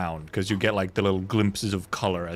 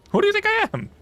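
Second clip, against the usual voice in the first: high squeaky mock outrage; mock indignant; 5 dB louder, faster than usual. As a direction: pitch way up, fake offended. Repeats defence, mock offended.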